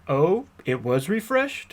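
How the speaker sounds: slow, incredulous repeat of the witness's answer; sarcastic echo. Real, not acted. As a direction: drag it out as if you don't believe a word. Lawyer echoes witness's answer mockingly.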